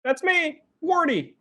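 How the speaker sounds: very high-pitched little character; high-pitched, eager character reply; Wardy's character voice. Acted. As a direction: answer in a high, put-on voice. Answers in Wardy's voice.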